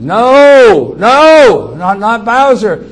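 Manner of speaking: high, very loud, drawn-out cry of refusal; screamed protest. Retold or imitated. Child screaming at the sheriff to spare his dog, anguished scream. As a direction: scream it in desperate protest, far above normal pitch. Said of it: "I screamed".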